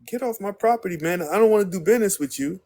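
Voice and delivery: high, heated, fast finish; angry telling off. Retold or imitated. Homeowner ordering the server away, angry and high. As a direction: run him off the property.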